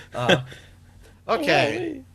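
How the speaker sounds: ordinary delivery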